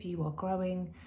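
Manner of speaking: normal talking voice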